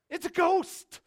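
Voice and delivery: loud, high cry of alarm; terrified scream. Acted. Disciples seeing a figure on the water, terrified scream. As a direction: cry out in sudden fright. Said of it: "they screamed out literally".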